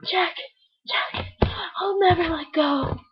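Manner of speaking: mock-tearful film heroine; Rose from Titanic. Parodying Rose calling to Jack, melodramatic and breathy.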